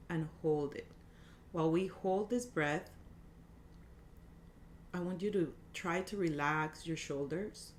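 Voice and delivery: soft, calm breathing guide; guided meditation. Acted. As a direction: gently guide each breath.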